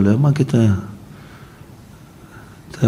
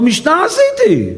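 high defensive protest; slower than usual. Acted. Rabbi defends himself to Elijah.

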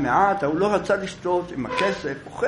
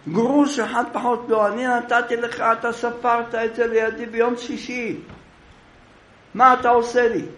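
indignant yell; 8 semitones higher than usual. Acted. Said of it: "ההוא צועק".

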